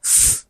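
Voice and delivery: "f" as a bare voiceless hiss; just the consonant "f", air only; isolated consonant sound. Shown as a demonstration. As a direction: sound out "f" without vibration, no vowel. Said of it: "it is pronounced as f".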